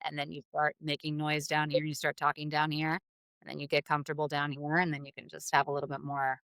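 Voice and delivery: low, soft voice down in the fry. Shown as a demonstration. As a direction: settle comfortably into the bottom register. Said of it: "go down into, like, your Fry voice".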